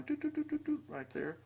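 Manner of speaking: very high, soft tooting sounds; sing-song doots. Real, not acted. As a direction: tap along the line playfully. Pointing out line on page.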